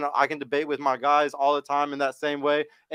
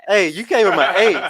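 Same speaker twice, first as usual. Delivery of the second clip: laughing tease; 6 semitones higher, 9 dB louder than usual. Real, not acted. Teasing friend about his rating.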